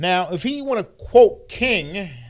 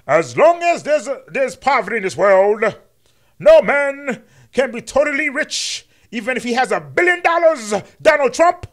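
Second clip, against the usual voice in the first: much higher, loud preaching voice; louder, higher mock King sermon with a jab at Trump; MLK impression; 8 semitones higher, 7 dB louder than usual. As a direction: orate like a Sunday sermon. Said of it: "my M L K impression".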